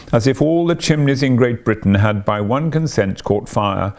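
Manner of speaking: ordinary delivery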